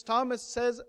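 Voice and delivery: ordinary talk, nothing marked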